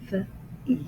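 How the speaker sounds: "V, E" as two separate sounds; "V, E" in slow, separated pieces; sounded out. Shown as a demonstration. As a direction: split the letter into its consonant, then "E". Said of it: "the sound is almost like a".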